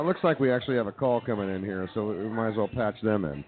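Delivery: ordinary talk, nothing marked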